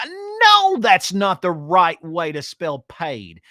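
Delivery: very high, loud then slow correction; correcting Alex, a high loud no then slow; exasperated. Retold or imitated. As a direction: shout the no, then spell it out slowly. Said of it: "And he was like".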